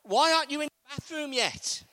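husband hurrying his wife to the bathroom; mild mock nagging. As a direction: chivvy her along, a bit sharp. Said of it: "shake her and say".

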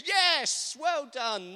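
loud, high crowd approval; hammed-up congratulation, pitched high; mock cheering. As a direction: cheer them on with over-the-top praise. Mock crowd applauding the prayer.

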